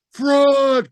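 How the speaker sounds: high, loud furious cry; angry crowd cry. Acted. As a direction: yell it like a mob. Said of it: "cried the maddened thousands".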